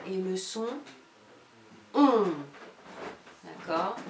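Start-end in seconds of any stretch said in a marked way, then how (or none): 1.9-2.3 on said louder, set apart; sound on stressed. Shown as a demonstration. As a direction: isolate the sound on clearly. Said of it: "la difficulté entre le son".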